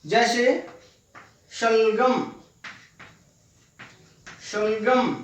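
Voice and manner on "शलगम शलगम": शलगम with श made tongue-to-palate; palatal sha. Shown as a demonstration. Teacher giving an example word for palatal sha, careful pronunciation. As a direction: say शलगम carefully, tongue lifted on the first sound. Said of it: "जीभ ऊपर की तरफ उठती है".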